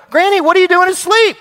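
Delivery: high, loud astonished call to Granny; calling out in disbelief at finding her asleep; surprised family call. Retold or imitated. Family finding grandmother asleep, high astonished call.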